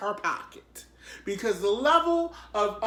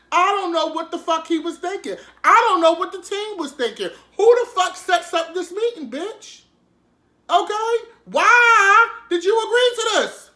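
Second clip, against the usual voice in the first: high, loud laughing fake sobs; half-laughing mock despair; mock crying; 10 semitones higher, 8 dB louder than usual. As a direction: wail in hammed-up disbelief. Mock-crying disbelief at the meeting.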